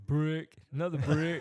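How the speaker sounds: excited commentator call. Pretend. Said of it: "You'd be saying".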